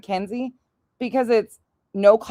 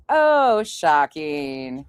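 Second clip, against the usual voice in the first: usual speaking voice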